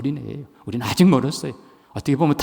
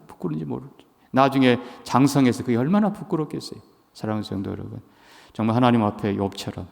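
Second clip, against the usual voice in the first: normal talking voice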